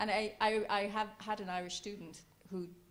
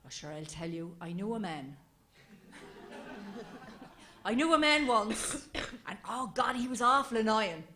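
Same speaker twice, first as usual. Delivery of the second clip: storyteller voice; 3 semitones higher, 10 dB louder than usual. Retold or imitated. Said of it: "he'd say".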